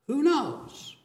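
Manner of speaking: high, wondering who knows; a father's hopeful high question; hopeful David. Acted.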